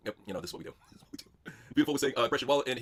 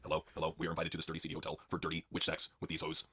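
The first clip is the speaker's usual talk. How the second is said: much lower, softer dopey voice; low Cena voice; 13 semitones lower, 12 dB quieter than usual. Acted. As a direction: play it dumb and low.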